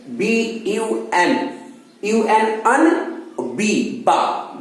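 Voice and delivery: letters then अन and बा stretched slowly; slow sounding out. Shown as a demonstration. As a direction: spell it out and drag each sound. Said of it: "teacher sounds out BUN". (धीरे-धीरे ध्वनि जोड़ना)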